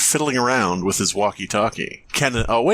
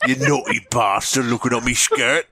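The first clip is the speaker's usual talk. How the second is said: put-on British accent. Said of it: "I'm British".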